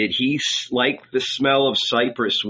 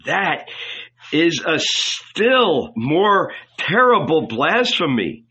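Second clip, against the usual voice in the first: raised, slow outcry of horror; crying out; 6 semitones higher, 3 dB louder, slower than usual. A narrator's reading. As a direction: cry it out, appalled. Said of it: "cried the rabbi".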